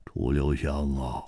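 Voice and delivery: low, soft, rueful address; wry smile. A narrator's reading. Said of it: "苦笑着说". (低声苦笑着说)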